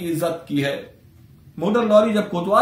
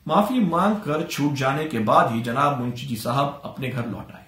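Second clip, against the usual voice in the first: usual speaking voice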